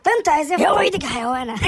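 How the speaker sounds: yelling furiously at the dog to speak; angry shout. Acted. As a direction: bark the order with full rage. Shouting at a silent animal.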